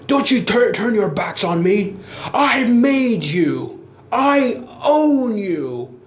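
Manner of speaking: high, slow, shouted threat; furious boss. Retold or imitated. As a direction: bellow at the wrestlers walking out. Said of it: "Vince is like".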